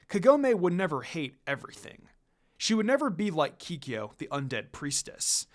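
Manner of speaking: ordinary delivery, nothing marked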